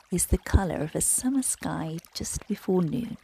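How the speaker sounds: usual speaking voice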